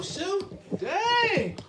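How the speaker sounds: usual speaking voice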